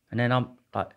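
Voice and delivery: ordinary delivery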